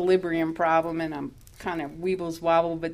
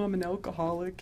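softer admission; quiet confession; 7 dB quieter than usual. Retold or imitated. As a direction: let the confession drop quietly.

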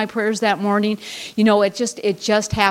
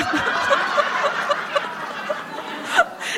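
high breathless giggle; real laugh at the absurd memory; breathy laugh; 4 dB quieter than usual. Real, not acted. Telling her group about husband's arrival, breathless laugh.

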